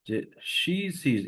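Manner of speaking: "she sees" with a drawn-out long ee. Shown as a demonstration. Teacher showing a class the long ee sound, slowly. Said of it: "একটু long করে করব ই".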